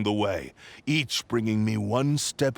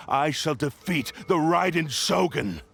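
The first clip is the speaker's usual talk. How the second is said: higher, ringing vow of victory; triumphant declaration; 7 semitones higher than usual. Acted. Possessed sword vowing to beat the Raiden Shogun, triumphant. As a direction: proclaim it like a battle cry.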